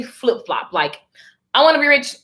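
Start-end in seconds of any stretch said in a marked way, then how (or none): 1.5-2.2 lifted pitch, mock-insistent wanting; mock eager wish. Acting out flip-flop energy, mock eager wish. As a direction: punch it up as the wanting side.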